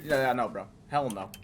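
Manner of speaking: normal talking voice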